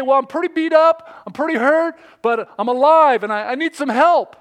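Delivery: high, urgent climber's report; loud plea. Acted. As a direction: call your plight up the cliff. Hurt climber pleads upward.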